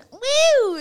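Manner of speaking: high, loud "woo"; gleeful whoop. Retold or imitated. Loves it when new people come, gleeful high whoop.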